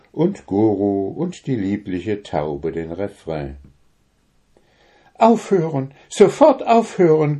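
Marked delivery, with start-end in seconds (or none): 5.2-7.4 much higher, louder protest to stop; empört und laut; indignant cry. A narrator's reading. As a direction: shout them down from the tree. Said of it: "gurrte es da aus der Eiche".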